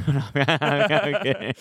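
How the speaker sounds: slow, laughing take-back. Real, not acted. Taking back a joke to viewers, laughing slowly. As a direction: laugh through the retraction. Joking after "You're right.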